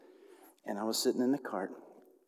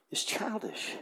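whisper; 6 semitones higher, 7 dB quieter, slower than usual. Real, not acted. Confessing childish hurt.

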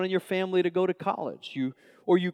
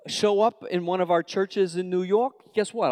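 ordinary talk

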